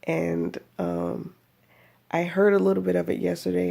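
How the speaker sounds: ordinary talk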